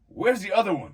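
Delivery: loud, high startled shout; retold alarm. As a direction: call out in sudden panic, re-enacted. Retold panic over missing dog.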